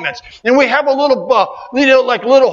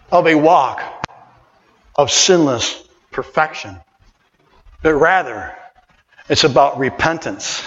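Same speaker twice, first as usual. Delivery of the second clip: solemn reading; 8 semitones lower than usual. Acted. Quiet reflective reading of statement.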